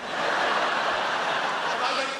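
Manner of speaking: ordinary talk, nothing marked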